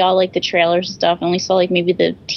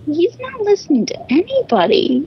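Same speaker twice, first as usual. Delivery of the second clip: high-pitched mock exasperation; exasperated mock complaint; 11 semitones higher than usual. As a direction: whine it in a high, put-on voice. Repeats her exasperated movie reaction.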